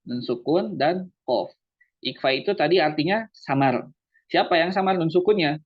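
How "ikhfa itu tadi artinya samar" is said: ordinary talk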